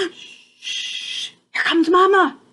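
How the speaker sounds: whispered shh then a loud excited call; hissed warning. Acted. Baby bird hushing siblings as Mama comes home. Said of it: "hissed Pip".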